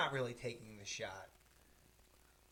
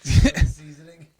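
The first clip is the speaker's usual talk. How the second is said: mock loud; 3 semitones higher, 23 dB louder, much slower than usual. Mock correction of cocaine.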